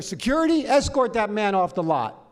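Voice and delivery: high, commanding call; calling security. Retold or imitated. Studio man calling security on a guest, commanding call. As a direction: sound the order to throw someone out. Toad calls security on him.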